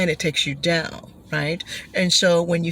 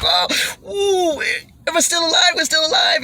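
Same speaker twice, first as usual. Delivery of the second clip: mock relieved shout; 8 semitones higher than usual. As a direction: shout with relief at surviving the drop.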